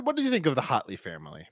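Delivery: ordinary talk, nothing marked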